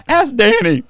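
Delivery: high, loud, hammed-up snitch; mock eager snitch. Snitching to the FBI, hammed-up high and loud. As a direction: shout it out like an eager rat.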